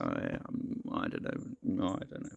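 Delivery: low, very soft mumbling; half-voiced, unsure musing; puzzled, head-scratching. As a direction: act out doubtful pondering under your breath. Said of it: "sort of scratching their heads".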